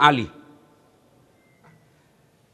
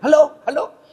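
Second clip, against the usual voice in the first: calling into the phone; 10 semitones higher, 4 dB louder than usual. Retold or imitated.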